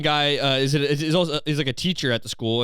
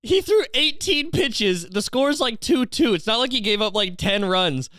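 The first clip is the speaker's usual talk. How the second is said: incredulous; 9 semitones higher than usual. Real, not acted. Incredulous ranting after laughing.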